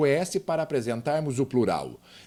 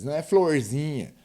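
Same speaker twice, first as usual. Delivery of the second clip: ordinary talk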